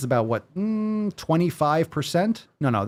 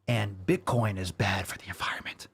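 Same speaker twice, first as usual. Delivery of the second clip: soft whispered aside; conspiratorial whisper; 5 semitones lower, 8 dB quieter than usual. Pretend. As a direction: whisper it like a secret.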